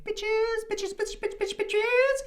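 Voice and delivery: very high mocking falsetto; imitated girly heckler, sky-high pitch. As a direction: squeal it like a taunting girly catcall. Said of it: "making this girly voice".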